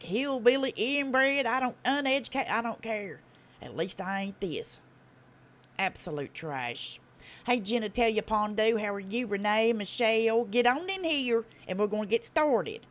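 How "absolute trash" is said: "Absolute trash" low, soft and slow; lowered, unhurried scorn; low dismissal. Real, not acted. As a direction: drop it quietly as a final verdict. Same verdict, muttered as afterthought.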